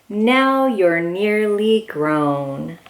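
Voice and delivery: ordinary delivery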